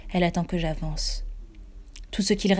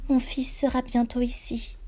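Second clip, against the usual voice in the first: ghostly woman; 6 semitones higher than usual. A narrator's reading. Ghost mother promising her son returns. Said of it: "dit-elle".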